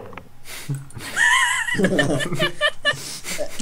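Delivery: long high giggle; high-pitched laugh. Real, not acted. Laughs at the insult.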